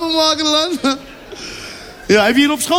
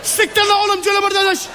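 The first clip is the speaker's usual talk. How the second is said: hoog, luid Turks vloeken; boos schelden; 7 semitones higher than usual. Acted. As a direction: scheld woest in het Turks. Driver shouts Turkish curses. (chauffeur scheldt boos in het Turks)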